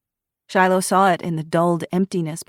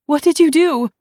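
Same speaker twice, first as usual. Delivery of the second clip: much higher, louder horrified cry; 11 semitones higher, 8 dB louder than usual. A narrator's reading. Daughter finding the empty bottle, horrified. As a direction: gasp it out in horror. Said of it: "in horror".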